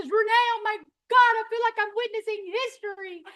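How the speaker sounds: high, loud, thrilled voice of an excited friend. Retold or imitated. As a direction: gush like a new friend amazed at her story.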